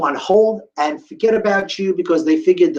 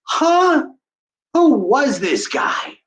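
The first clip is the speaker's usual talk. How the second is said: astonished; 11 semitones higher, much slower than usual. Real, not acted. Amazed at stranger's kindness.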